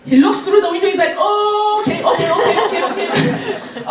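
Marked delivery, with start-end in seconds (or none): 1.2-3.8 higher, laughing string of okays; startled agreement. Retold or imitated. Security guard seeing the baby in the back, startled and laughing. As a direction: realize at once and wave them through.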